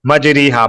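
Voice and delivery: "مجری ہا" as maj-REE-haa; wrong "ri" reading. Shown as a demonstration. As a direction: ee vowel after the r in "مجری ہا". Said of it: "پڑھنا غلط ہے".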